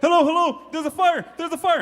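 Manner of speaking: put-on high, loud urgent child calling for help; excited kid on phone. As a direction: shout it into a pretend phone like a panicked kid. Boy calling 911 in game.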